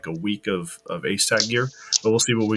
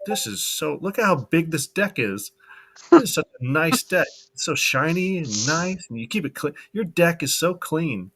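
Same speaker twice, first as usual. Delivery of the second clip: higher-pitched mock admiration; imitated gushing admirer; 6 semitones higher than usual. Imagined video of Croak admiring the new deck, mock gushing. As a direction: play someone cooing over a prized deck. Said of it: "saying, like".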